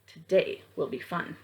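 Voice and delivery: ordinary talk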